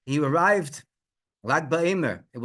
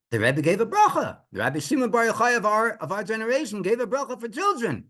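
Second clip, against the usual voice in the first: indignant disbelief; 8 semitones higher than usual. Acted. Everyone reacting to the failed bracha, indignant disbelief. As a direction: protest as if it is impossible. Protests that blessing should have worked.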